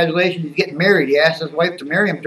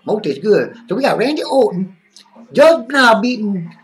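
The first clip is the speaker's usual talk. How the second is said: put-on character voice, pitched up and swinging; Skip. Skip chatting to viewers about wrestling, put-on excitable character voice. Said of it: "So, so it's Skip now".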